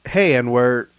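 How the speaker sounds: a sudden loud, friendly Hey; loud mock greeting. Retelling the old lady who greets him loud and bright. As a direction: call it out loud and cheerful. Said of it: "and goes".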